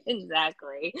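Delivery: laughing agreement. Real, not acted. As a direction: agree while still laughing.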